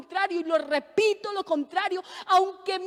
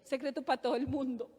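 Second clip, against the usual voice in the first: voz de secreto; 5 semitones lower, 8 dB quieter, faster than usual. Acted. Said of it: "aquí un secreto".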